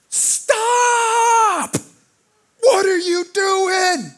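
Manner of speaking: high, shrill-free yelling call across the camp; shouted stop. Retold or imitated. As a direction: yell it out like an angry pastor. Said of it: "I hear him go".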